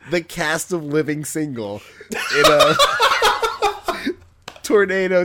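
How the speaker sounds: words pushed through giggles, slowed down; trying to talk through laughter; laughing while talking. Real, not acted. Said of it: "I can't even say it without laughing".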